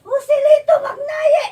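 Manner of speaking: crying insistence. Real, not acted. Suspect insisting on his real name, crying out. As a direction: insist on the name through sobs.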